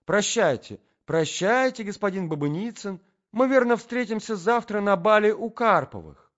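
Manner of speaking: high, loud singsong goodbye; effusive farewell. Acted. Lady bids the tall gentleman goodbye, effusive. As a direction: call it out gaily, well up in pitch.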